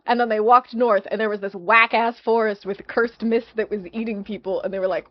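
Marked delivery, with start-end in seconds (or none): none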